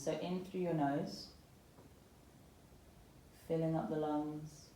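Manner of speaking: calm, unhurried yoga meditation voice with long pauses; guided meditation. Acted. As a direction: gently guide them into settling and softening, phrase by phrase. Guides breathing and body scan.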